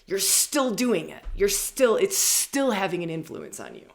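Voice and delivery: loud, slow, emphatic pronouncement; dark goddess. Acted. Dark goddesses answering those who think they're healed, loud and firm. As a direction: confront them forcefully, leaning hard on key words. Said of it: "Dark goddesses are saying".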